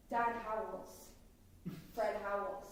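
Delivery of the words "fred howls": ordinary talk, nothing marked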